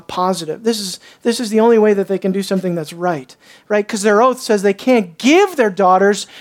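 ordinary talk, nothing marked

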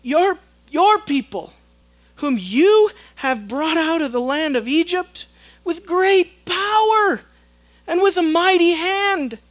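an earnest plea to an angry God; pleading Moses. Acted. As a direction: beg God to relent. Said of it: "I imagine Moses had tears".

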